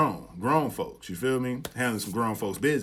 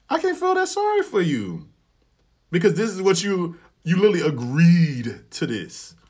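much higher and louder, fed up; telling his friend he can't pity her; exasperated; 7 semitones higher, 7 dB louder than usual. Retold or imitated. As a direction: throw the words up in disbelief.

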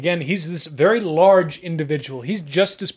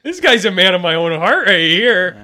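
a lightly put-on, louder character voice; same folksy informant voice; Petey voice; 2 semitones higher, 5 dB louder than usual. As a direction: player joining the Petey voice. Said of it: "Petey voice".

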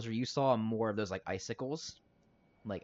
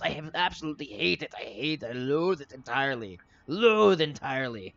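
higher-pitched, theatrical villain tirade; hateful villain rant; 5 semitones higher, 4 dB louder than usual. Acted. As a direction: spit out loathing, building to a loud finish. Villain rants about hating Bahumas.

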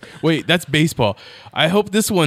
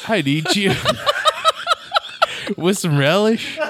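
laughing. Real, not acted. Drunk silly line laughing.

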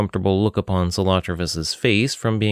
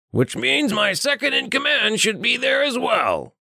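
high nasal-free gruff friend. Acted. Richter calls to Saladia from behind, high put-on voice. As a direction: call out from behind her as Richter.

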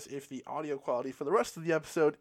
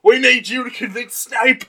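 Hagrid plea; 4 semitones higher, 11 dB louder than usual. Acted. Hagrid voice asking Harry.

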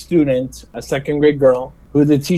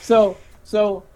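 ordinary delivery

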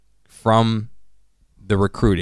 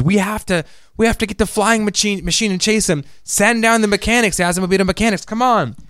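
fake tearful urgency; 10 semitones higher than usual. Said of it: "he turns the waterworks out".

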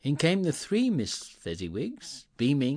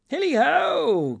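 high, loud, drawn-out halloo; jovial holler; 10 dB louder, slower than usual. A narrator's reading. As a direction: sing out a long merry shout. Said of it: "cried old Fezziwig".

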